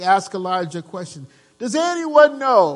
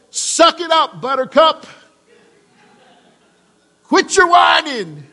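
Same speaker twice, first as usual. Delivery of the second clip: mock crusty old man, higher and louder; gruff old guy; 9 semitones higher, 4 dB louder than usual. Preacher mocking what God doesn't say to Elijah, hammed-up old guy. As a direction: bark it like a grumpy old churchgoer. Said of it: "some really old guy says that".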